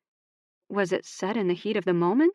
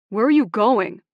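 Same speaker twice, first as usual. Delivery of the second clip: louder, raised call after her; yelling; 4 semitones higher, 8 dB louder than usual. A narrator's reading. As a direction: yell after her in the rain. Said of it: "Ox yelled".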